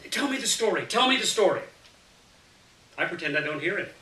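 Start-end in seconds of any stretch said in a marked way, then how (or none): 0.1-0.9 put-on higher child's holler; a kid demanding a story, pitched up. 0.9-1.6 put-on high, louder child's holler, pushing harder; insist, louder this time.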